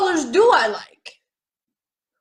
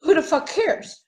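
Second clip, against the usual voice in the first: ordinary talk, nothing marked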